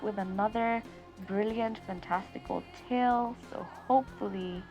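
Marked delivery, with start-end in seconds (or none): none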